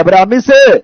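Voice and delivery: ordinary talk, nothing marked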